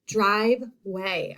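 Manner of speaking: driveway slowly split into drive and way; syllable by syllable. Shown as a demonstration. Teacher points out the long I, driveway in two slow beats. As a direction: draw out drive, then a separate way. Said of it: "I hear that long I sound".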